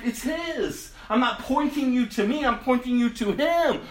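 ordinary talk, nothing marked

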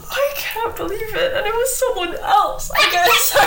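high, laughing mock outrage. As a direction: cry out in fake heartbreak while laughing. Mock outrage over cheating wife.

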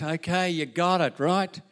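ordinary delivery

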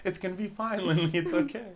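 high, loud, hammed-up comforting; teasing friend voices mom comforting her daughter, high and loud; mock reassuring mom. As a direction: fake soothing while hiding behind the child. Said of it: "I can just picture you".

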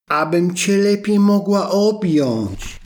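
high, slow, cooing answer; wolf faking grandma. Acted. Wolf in disguise answering the girl, in a high slow cooing voice. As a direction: coax her closer.

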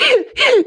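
high giggle that sounds like crying; hysterical giggle. Retold or imitated. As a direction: imitate stressed, tearful-sounding laughter. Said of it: "hysterically laughing in the way where I'm like".

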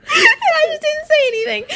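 squealing with laughter; high shrieking laugh through words; laughing hard. Real, not acted. Laughing at the deleted video.

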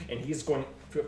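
ordinary talk

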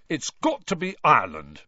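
talking through a mouthful of potato; thick, mouth full of food. Acted. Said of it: "thickly through a mouthful of potato".

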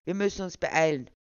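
an official losing patience; impatient. Acted. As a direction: push to get moving. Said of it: "zusehends ungeduldig werdend".

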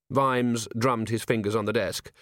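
ordinary delivery, nothing marked

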